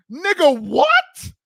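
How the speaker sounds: high-pitched, incredulous outburst; baffled squeal of disbelief. Real, not acted. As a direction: leap up high on "what" in disbelief. Reacting to a contradictory quote.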